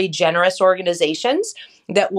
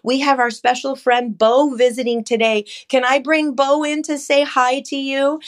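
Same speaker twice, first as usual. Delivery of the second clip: put-on talking to a child; 6 semitones higher than usual. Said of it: "I can say".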